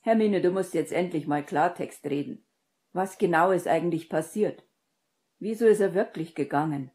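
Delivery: loud, insistent questioning; commanding, forceful voice; firm demand. A narrator's reading. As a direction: press for answers with authority. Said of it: "befahl Harry bestimmt".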